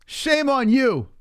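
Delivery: high, slow mock scolding; high, drawn-out sarcastic shame.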